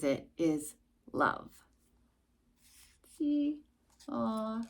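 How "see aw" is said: plain reading voice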